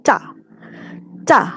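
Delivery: "ca" tight and clipped, like cicak; tense ca. Shown as a demonstration. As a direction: snap the c in "ca". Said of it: "seperti C". ("ca" tegang seperti cicak)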